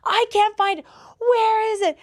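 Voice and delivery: high, loud mock yelling, stretched out; hammed-up tantrum over missing ketchup; imitating angry brother. Teenage brother can't find the ketchup, mock furious yell. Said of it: "got so mad".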